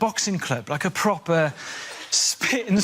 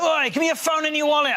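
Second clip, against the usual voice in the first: mugger voice; 8 semitones higher than usual. Retold or imitated. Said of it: "a bloke will be like".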